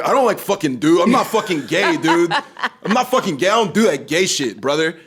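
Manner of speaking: loud, high mock frat boy voice; frat bro. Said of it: "you said that like a frat boy be like".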